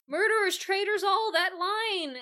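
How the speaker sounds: high, crying Lear lament; grieving Lear. Retold or imitated. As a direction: wail it like Lear over Cordelia. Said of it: "And Lionheart's all Lear".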